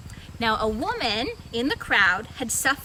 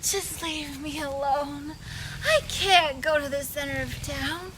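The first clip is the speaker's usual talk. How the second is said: weepy sick woman. Acted. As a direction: whimper that you can't go. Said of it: "she was laying there going".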